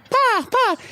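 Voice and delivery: very high squawked caws; shrill-pitched mock bird call; cawing bird. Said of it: "whenever a bird's like".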